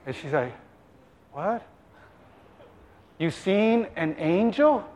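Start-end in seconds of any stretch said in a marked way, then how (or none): 1.3-4.8 softly stunned question; hushed astonishment. Acted. Elizabeth reads her husband's signs, hushed and astonished. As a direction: drop the volume in disbelief. Wife grasps husband's mimed news.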